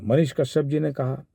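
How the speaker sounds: normal talking voice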